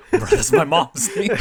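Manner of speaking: high, slow laughing speech; laughing mock protest. Kasuga hearing his mom's name, laughing mock protest. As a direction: crack up while imitating disbelief.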